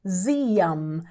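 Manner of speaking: "Ziam" with lips closed on the final m, /ziɐm/; "Ziam" ending firmly on m; final syllable alone. Shown as a demonstration. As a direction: close the mouth at the end of "Ziam". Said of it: "terminando com ziam".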